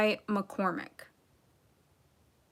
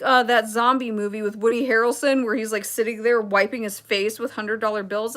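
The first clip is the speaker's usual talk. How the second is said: ordinary talk, nothing marked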